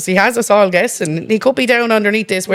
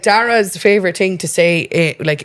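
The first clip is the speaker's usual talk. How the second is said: ordinary delivery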